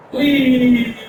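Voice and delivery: put-on loud, high, childish whee; a hammed-up squeal of fun sliding down; mock whee. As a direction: pretend playground glee. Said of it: "That's what you say when you're having fun".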